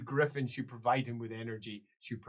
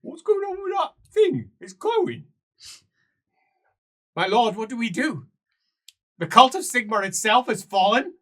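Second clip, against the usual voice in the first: much higher, louder startled voice; alarmed cry; 13 semitones higher, 18 dB louder than usual. Acted. Servant startled by the glowing book, alarmed. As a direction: shriek at the glowing thing. Frightened figures reacting.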